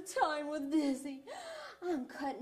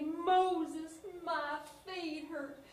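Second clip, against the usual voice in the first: a lightly voiced whine, slower on the complaint; whiny complaining Israelites; 4 semitones higher, much slower than usual. Israelites complaining to Moses, a light whine. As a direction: moan it like a tired walker. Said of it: "some of those Israelites started to complain".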